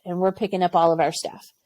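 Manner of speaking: ordinary talk, nothing marked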